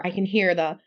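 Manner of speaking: ordinary talk, nothing marked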